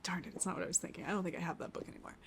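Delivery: fast, hushed muttering to herself; muttered to self. Real, not acted. Guesser mutters to herself after a wrong guess, hushed and fast. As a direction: grumble it under your breath.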